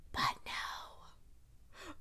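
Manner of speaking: breathless whisper of letdown; whispered despair. Real, not acted. Vlogger lamenting the missed proposal, whispered. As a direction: drop to a hush of defeat. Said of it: "whispered despair".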